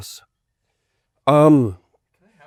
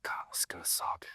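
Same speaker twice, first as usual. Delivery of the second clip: whispering; 19 dB quieter, faster than usual. Real, not acted. Dreading the hot sauce.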